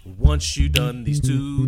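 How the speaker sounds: ordinary talk, nothing marked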